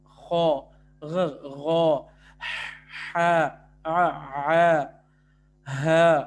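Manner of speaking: each letter voiced loud and drawn from its point of articulation; articulation demo. Shown as a demonstration. Tutor demonstrating Arabic letter sounds, loud and deliberate. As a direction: sound out the letters one by one for the class. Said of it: "یہ خو ہے".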